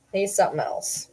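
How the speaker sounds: ordinary talk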